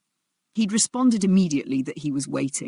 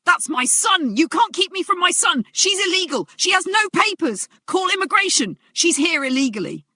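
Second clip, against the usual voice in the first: much higher and louder, screaming while held back; screaming rage; 6 dB louder than usual. Acted. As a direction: scream it at the whole lobby. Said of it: "he was still screaming".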